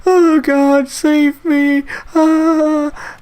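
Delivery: hammed-up girly panic; scared whining plea. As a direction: imitate a terrified crybaby. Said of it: "and I was like".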